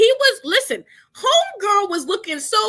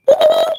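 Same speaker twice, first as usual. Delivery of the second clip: high, louder "woo" calls checking the sound; mic test hoots; 13 semitones higher, 6 dB louder, faster than usual. Real, not acted. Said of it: "I'm sounding like a chipmunk?".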